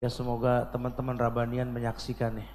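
usual speaking voice

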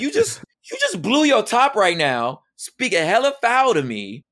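high, heated indignant rant; indignant, voice pushed up high. Retold or imitated. Ranting about wife's outburst.

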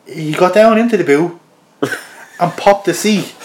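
ordinary delivery

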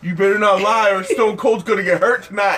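mock threat. Acted kid threat to friends.

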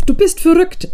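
hoch, halb tadelnd, halb bewundernd. A narrator's reading. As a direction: necken mit heimlicher Bewunderung. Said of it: "es klang so etwas wie Bewunderung mit". (bewundernd, mit hoher Stimme)

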